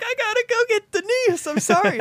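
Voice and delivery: high, frantic apology; mock urgency. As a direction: blurt it out like you must leave now. Stuntman retorts while running off.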